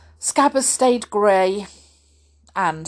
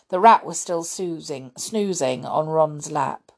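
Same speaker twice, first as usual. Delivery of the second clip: ordinary delivery, nothing marked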